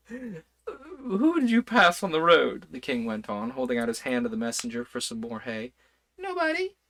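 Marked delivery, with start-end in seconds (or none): 0.1-2.7 king voice. A narrator's reading. King speaking, slow, high. 6.2-6.8 messenger voice. A narrator's reading. Messenger answer, very high.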